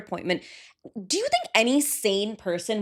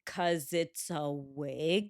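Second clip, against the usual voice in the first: slow, low and quieter, rising into a question; retold incredulous answer; 6 semitones lower, 8 dB quieter, much slower than usual. [name] answering a lawyer on the stand, retold incredulous. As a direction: spell out the obvious, drawn out.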